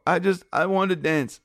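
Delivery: hammed-up sob story, half a joke; fake tears. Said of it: "I muster up some fake tears".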